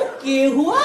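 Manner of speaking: scoffing echo with a rising end; imitated Haryanvi taunt; mocking Haryanvi. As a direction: throw it back mockingly in Haryanvi. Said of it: "वो बंदा मुझे देखते ही हंस दिया".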